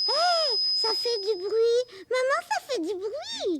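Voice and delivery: put-on high, loud, drawn-out child's excitement; delighted squeal, stretched words; excited discovery. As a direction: call out to mum, thrilled by the noise. Child amazed the box makes noise.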